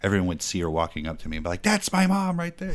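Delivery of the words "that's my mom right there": much higher, proud taunt; high mock kid announcement. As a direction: point her out to everyone. Kid announcing mom at desk.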